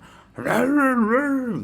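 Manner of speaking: loud, high dog-attack snarling; snarling dog pack imitation. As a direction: imitate dogs savaging someone at night. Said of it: "them just attacking other people".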